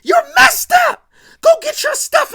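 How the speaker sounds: shrieking at her brother to get out; furious high yell. Retold or imitated. Sister screaming at her brother while hitting him. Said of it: "she hits J R like".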